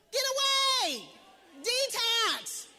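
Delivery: loud, high command; forceful shout. Real, not acted. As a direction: bark it out to the crowd. Preacher urges cutting off negativity.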